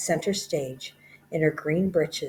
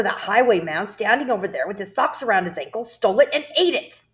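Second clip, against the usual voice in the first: ranting headmistress; 4 dB louder than usual. Acted. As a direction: build from spite to outrage. Headmistress tells of the theft.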